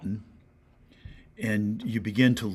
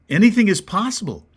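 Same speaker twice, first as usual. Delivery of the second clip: high and loud, anything punched; proclaimed; 9 semitones higher, 7 dB louder than usual. Real, not acted. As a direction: ring it out as a promise. Preacher proclaims Jesus' answer.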